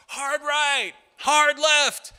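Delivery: high, loud rafting calls; yelling commands. Retold or imitated. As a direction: shout directions across the raft. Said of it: "you yell out".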